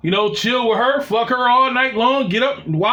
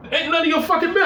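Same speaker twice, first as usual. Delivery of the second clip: angry retort; 9 semitones higher, much faster than usual. Retold or imitated. Heated phone argument.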